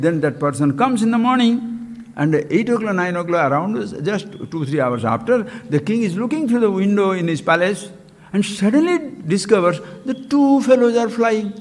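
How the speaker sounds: ordinary talk, nothing marked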